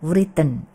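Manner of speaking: WRITTEN as w-RIT-ten; sounded w. Shown as a demonstration. Teacher calls it wrong, mispronounced. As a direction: push out the w in WRITTEN. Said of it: "memaksakan W-nya kedengeran".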